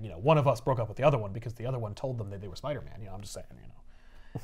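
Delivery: soft, fast sly dig; pointed passive jab. Retold or imitated. Peter sniping at Felicia, soft sly dig. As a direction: slip the jab in quietly. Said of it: "He's like".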